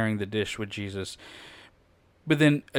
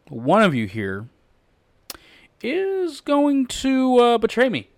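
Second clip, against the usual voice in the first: dramatic high betrayal line; 12 semitones higher, 6 dB louder, slower than usual. Acted. Jesus at dinner telling friends of betrayal, hammed-up high and slow. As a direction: pitch soars high and slow on is going to betray me. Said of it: "one of them speaks up and says".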